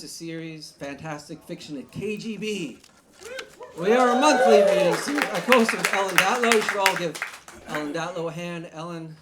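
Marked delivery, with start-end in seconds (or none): 3.8-8.7 loud announcing. Real, not acted. Host hypes crowd on mic.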